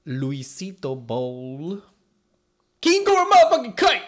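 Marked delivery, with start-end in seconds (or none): 2.8-4.0 high, loud fighter introduction; ring announcer. Acted. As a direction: announce it like a boxing emcee. Announcing team name like boxer.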